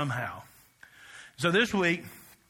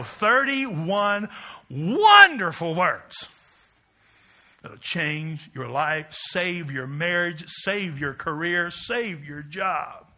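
slow preaching cadence; much slower than usual. Acted. Sermon climax, slow cadence.

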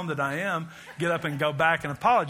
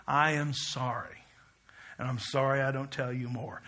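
soft apology; 5 semitones lower, 6 dB quieter than usual. Shown as a demonstration. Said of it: "this is the word he gave you".

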